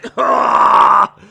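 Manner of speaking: loud burst of laughter. Real, not acted. Streamer cracking up at his own joke, loud laugh. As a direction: crack up loudly.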